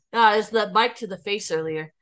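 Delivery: ordinary delivery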